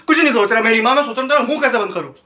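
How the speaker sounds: fed-up comic rant; exasperated outburst. Acted. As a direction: fire back like a pestered boyfriend at his limit. Exasperated retort to repeated question.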